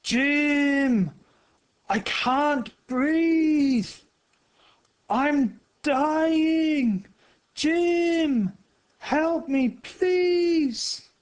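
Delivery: high, loud, desperate cries for help; panicked shout. A narrator's reading. Oscar buried under garbage, begging Jim for help, panicked high shouting. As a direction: scream for Jim to save you, voice breaking. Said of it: "Oscar began to shout".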